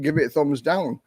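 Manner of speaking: ordinary talk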